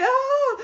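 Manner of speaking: loud, high re-enacted shriek; mock scream. Streamer re-enacting her scare at an imagined gunman, mock scream. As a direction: scream as if caught by someone behind you.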